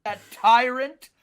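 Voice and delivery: building loud rant that slows on tyrant; ranting Stallone. Retold or imitated. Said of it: "he's like".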